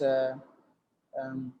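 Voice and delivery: ordinary delivery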